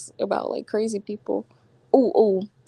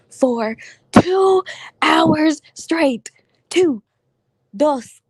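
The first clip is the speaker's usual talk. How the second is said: rising higher and louder in disbelief; exasperated; 7 semitones higher, 6 dB louder, much slower than usual. Real, not acted. Telling viewers about two hours of calls, loud exasperation. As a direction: build up to an outraged two hours.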